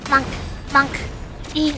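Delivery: ordinary talk, nothing marked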